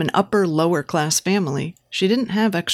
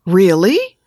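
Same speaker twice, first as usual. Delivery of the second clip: louder, sharply rising disbelief; surprised question; 7 dB louder than usual. A narrator's reading. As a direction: ask it as if you can't believe it. Surprised by his reassurance.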